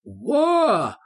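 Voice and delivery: high, drawn-out whoop; stretched, high exclamatory call; quoting father's exclamation. A narrator's reading. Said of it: "как говаривал мой папа".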